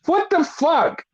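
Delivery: baffled, pitch jumping up on the question; acted bewilderment. Host reacts to the neck brace he didn't take off, incredulous.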